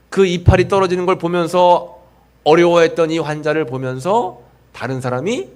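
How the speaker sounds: normal talking voice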